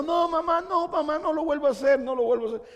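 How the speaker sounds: put-on high childish pleading voice; child begging. Preacher voicing a punished child begging his mother, high pleading. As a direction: plead like a small kid being spanked. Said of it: "y está diciendo".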